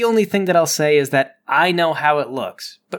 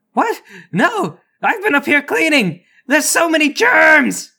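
high-pitched, rising to a shout on the germs; Thomas the deckhand; 11 semitones higher, 4 dB louder than usual. Acted. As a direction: squeak it out like a germ-phobic sailor. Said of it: "Thomas comes to the side of the deck and says".

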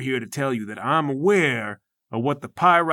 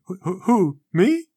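confused guy in the stands; 3 semitones higher than usual. Acted. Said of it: "he points to himself".

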